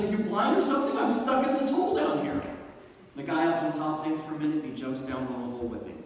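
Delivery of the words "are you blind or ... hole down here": high, loud acted exasperation; indignant outburst from the stuck man, hammed up; exasperated. Stuck deputy to a colleague above him, exasperated.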